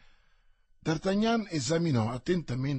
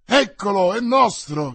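loud, high cry of pursuers; triumphant shout; 5 semitones higher, 9 dB louder than usual. A narrator's reading. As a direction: shout it as if catching the quarry. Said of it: "gridarono i sopraggiunti". (grido forte degli inseguitori)

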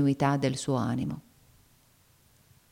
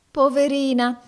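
much higher, louder cry of mock pity; pitying old woman; 9 semitones higher, 6 dB louder than usual. Old woman coos over the girl with put-on pity. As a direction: coo it with fake sympathy. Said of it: "replicò subito la donna".

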